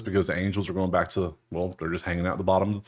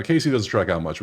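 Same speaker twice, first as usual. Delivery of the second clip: ordinary delivery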